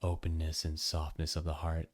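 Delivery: low, soft guru intonation; soft and hushed, quoting the teacher; quoted guru line. Retold or imitated. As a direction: mimic the guru's hushed pronouncement. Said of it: "and he goes".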